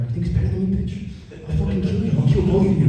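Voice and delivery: a lightly voiced muttered threat to himself; aggressive whisper. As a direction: hiss it under your breath like a jealous ex. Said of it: "whispering aggressively to himself".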